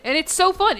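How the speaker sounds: excited. Real, not acted. Excited gaming chatter.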